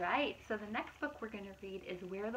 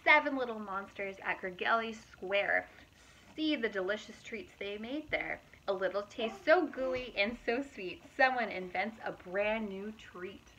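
lively, louder storybook narration; reading to children; 5 dB louder than usual. A narrator's reading. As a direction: read it out brightly to a young audience. Reading picture book aloud.

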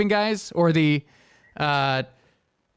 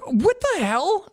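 slow, much higher what the hell; shocked; 12 semitones higher, slower than usual. Retold or imitated. After his car starts with the wrong key, slow shocked disbelief. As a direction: stretch it out in high disbelief. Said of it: "And I'm like".

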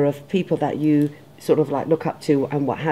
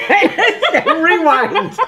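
high, loud laughing call to rewind; 11 semitones higher, 5 dB louder, much slower than usual. Real, not acted. Host confused by the title calls a rewind, laughing. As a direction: laugh while calling for a replay.

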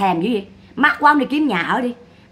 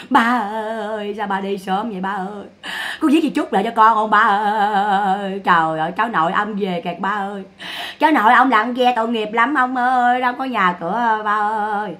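chanted, long-drawn imitation of keening; hammed-up sobbing lament with stretched calls; mock funeral wailing. Imitating a mourner crying over a dead father, mock wail. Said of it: "Lăn lết bò khóc cào cào".

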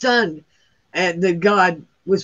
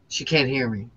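ordinary talk

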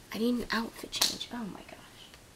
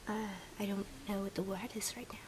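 usual speaking voice

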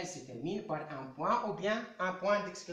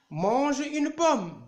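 loud, slow order, voice higher; command; 4 semitones higher, 6 dB louder, much slower than usual. Shown as a demonstration. Teacher giving pupils an example of an imperative, loud and commanding. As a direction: bark it slowly like a firm order. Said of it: "une phrase impérative".

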